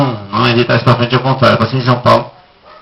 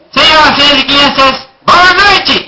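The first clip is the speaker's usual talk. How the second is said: puppet voice; 8 semitones higher than usual. Acted. Puppet repeats the greeting.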